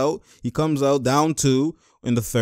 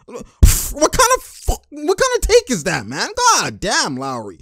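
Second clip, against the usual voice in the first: high-pitched disbelief; incredulous fan outburst; exasperated; 8 semitones higher, slower than usual. Real, not acted. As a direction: protest the bad shot, voice shooting up. Dismayed at a bad shot.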